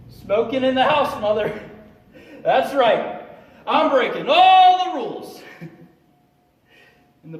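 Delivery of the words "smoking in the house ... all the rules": high, loud, taunting crow; gleeful defiance. Acted. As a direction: flaunt it at mother at full volume. Defying dead mother, acted.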